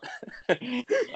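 breaks into a high giggle; can't hold the laugh in. Real, not acted. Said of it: "I'm trying not to laugh".

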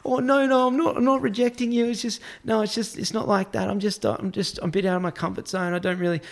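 high, hurried, nervous excuses; anxious, high-pitched stammering refusal; flustered protest. Retold or imitated. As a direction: backpedal in a panic without being rude.

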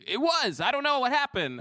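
lightly voiced high retold line; high-pitched [name] voice. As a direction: say it high and baffled. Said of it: "T I says".

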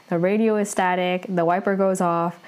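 ordinary talk

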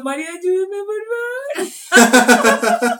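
high, drawn-out wail for help; mock drunken crying. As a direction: imitate a drunk friend's tearful plea, stretching the last word.

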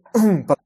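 harsh cough to clear a choked throat. Real, not acted. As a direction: clear the throat hard after choking. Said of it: "perdón, me atragante". (carraspeo fuerte tras atragantarse)

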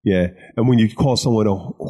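usual speaking voice

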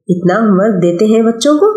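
plain reading voice

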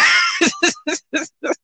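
high, helpless laughing; real laughter. Real, not acted. Laughing after doing a screamed meme impression, high laughter. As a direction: crack up at your own bit.

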